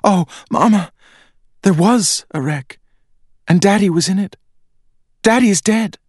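put-on a girl suddenly sure her daddy is dead; panicked girl. A narrator's reading. Girl tells her mother daddy died, in a high panicked cry.

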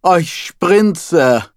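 high moaning "Oi, Sprinze" with a long falling Oi; voicing the rabbi moaning for his wife; mock moan. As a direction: moan the wife's name like a dubbed climax. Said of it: "I moaned".

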